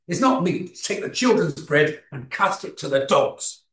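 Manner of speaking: forceful rebuke, each key word hit hard; harsh, dismissive tone. Shown as a demonstration. Preacher demonstrates the wrong way to voice Jesus' words, harshly. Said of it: "You could say it in that tone of voice".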